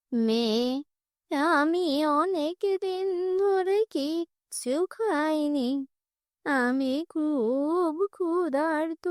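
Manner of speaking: put-on very slow, frail old woman begging; old beggar woman. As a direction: plead for food slowly and weakly.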